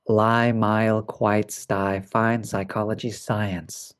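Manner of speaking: words run in pairs without stretching. Shown as a demonstration. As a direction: say the list briskly, softening toward the end. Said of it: "quite fast".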